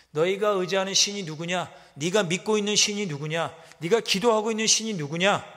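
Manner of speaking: enemy king jeering at the people; mocking taunt. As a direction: taunt the besieged city. Assyrians taunting Jerusalem's faith.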